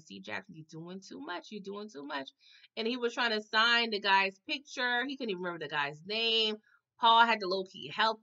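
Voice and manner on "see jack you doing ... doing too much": soft, disappointed chiding; soft tut-tut. Retold or imitated. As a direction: shake your head at Jack quietly. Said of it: "And I'm like".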